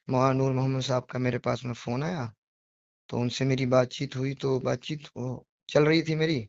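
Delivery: ordinary delivery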